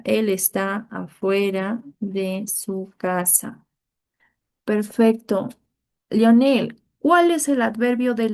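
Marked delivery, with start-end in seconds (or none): 0.0-3.7 slow, clear teacher dictation. Shown as a demonstration. Teacher dictating a sentence to pupils, slow and clear. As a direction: spell it out slowly for the class. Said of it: "nos quedamos con la primera".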